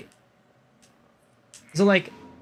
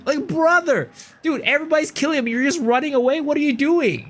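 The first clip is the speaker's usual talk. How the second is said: much higher, fast exasperated outburst; exasperated rant; 11 semitones higher, faster than usual. Real, not acted. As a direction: vent in disbelief, pitch way up. Venting about kid running away.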